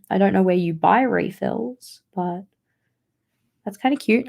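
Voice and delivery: ordinary talk, nothing marked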